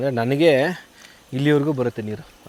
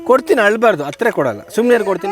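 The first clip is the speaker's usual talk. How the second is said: very high, loud sing-song; 9 semitones higher, 7 dB louder than usual. Real, not acted. Aunt hushing the crying baby in her arms, very high sing-song.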